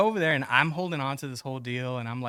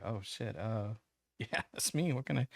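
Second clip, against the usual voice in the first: dismayed mutter; 3 semitones lower, 8 dB quieter than usual. Retold or imitated. Caught off guard by bailiff.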